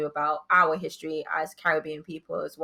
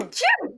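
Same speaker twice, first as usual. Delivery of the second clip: sudden real sneeze; 4 dB louder than usual. Real, not acted. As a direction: let out a sharp sneeze. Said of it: "I'm gonna sneeze two seconds".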